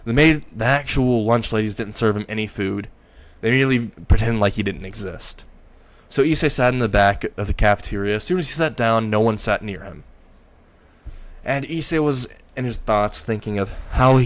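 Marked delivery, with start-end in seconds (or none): none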